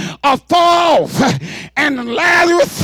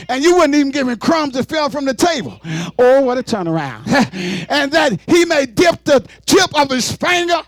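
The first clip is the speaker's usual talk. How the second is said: ordinary delivery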